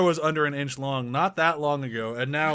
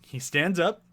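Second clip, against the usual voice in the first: ordinary talk, nothing marked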